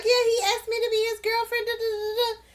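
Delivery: high, quick singsong mock bragging; happy gushing. Imitating Shayna telling the girls in the pods, happily gushing. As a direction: gush the news in a light, high voice. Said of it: "all happy".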